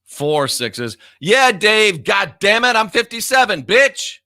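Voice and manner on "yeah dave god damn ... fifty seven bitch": loud, high-pitched angry retort; irritated outburst. Real, not acted. Streamer snapping back at Dave in the chat, irritated. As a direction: fire back at a heckler, voice jumping up.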